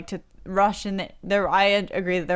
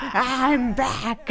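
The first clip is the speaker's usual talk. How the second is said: monster voice; 5 semitones higher than usual. Retold or imitated. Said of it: "like, monster voice".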